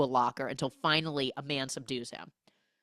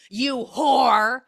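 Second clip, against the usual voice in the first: loud, high hammed-up insult; mock accusation; 8 semitones higher, 10 dB louder than usual. Jealous neighbour confronting her from his car, mock angry accusation. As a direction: hurl it loudly, drawing it out. Acted accusation in retold quarrel.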